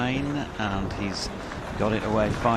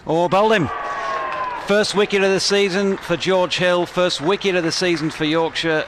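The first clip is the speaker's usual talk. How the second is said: higher and louder, triumphant; excited wicket call; 7 semitones higher, 6 dB louder than usual. Real, not acted. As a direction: celebrate the first wicket of the season. First wicket of the season.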